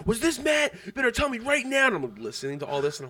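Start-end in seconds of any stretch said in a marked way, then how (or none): 0.1-1.9 high, urgent mock interrogation; mom freaking out. Imitating Michaela's mom freaking out, high frantic demand. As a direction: demand answers, pitched way up. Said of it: "Michaela's mom's freaking out".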